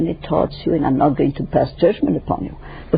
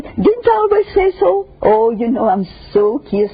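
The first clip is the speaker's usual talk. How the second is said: gloating; 5 semitones higher than usual. Pretend. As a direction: rub your hands and crow. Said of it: "you rub your hands and say".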